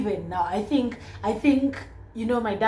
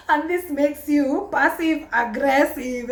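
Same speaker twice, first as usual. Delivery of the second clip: laughing; 8 semitones higher, 6 dB louder, slower than usual. Real, not acted. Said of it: "Let's put that across".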